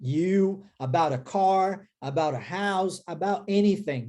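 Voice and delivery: slow, deliberate "Dreamed about you, about a car"; slow model phrases. Shown as a demonstration. Teacher modelling "about" phrases slowly for students. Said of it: "é assim que a gente fala".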